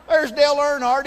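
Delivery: ordinary delivery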